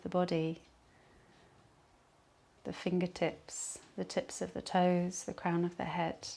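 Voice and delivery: calm Yoga Nidra voice with long pauses; guided meditation. Acted. Leads a relaxation meditation.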